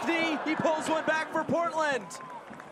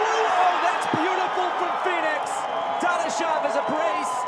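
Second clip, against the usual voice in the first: excited; 4 semitones higher, 6 dB louder than usual. Real, not acted. Commentator reacts to a goal.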